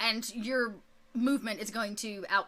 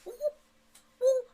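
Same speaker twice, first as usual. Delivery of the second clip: high, loud squealing protest; high goblin squeal of denial; frantic denial; 7 dB louder, faster than usual. Acted. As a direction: shriek your innocence. Hobgoblin denies blame.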